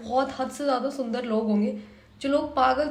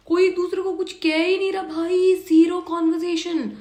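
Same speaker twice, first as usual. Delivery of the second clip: louder, exasperated complaint; frustrated outburst; 6 semitones higher, 5 dB louder than usual. Real, not acted. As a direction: vent at the screen. Frustrated at silent couple.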